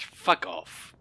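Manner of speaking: normal talking voice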